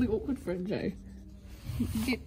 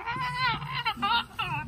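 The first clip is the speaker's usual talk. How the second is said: drifting cries; 11 semitones higher, much slower than usual. Pretend. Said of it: "She's like".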